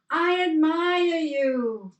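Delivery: high, loud, slow call of "I admire you"; father calling into the valley. Retold or imitated. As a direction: shout the praise out across the mountains. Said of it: "Watch this".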